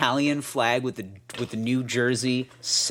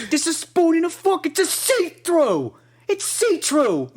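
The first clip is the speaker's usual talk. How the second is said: much higher, slow hammed-up sarcasm; sarcastic Jersey dad. Mimicking the dad explaining the see-through jars, much higher and slow. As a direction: stretch out see-through mockingly. Dad's rant voiced, slowing.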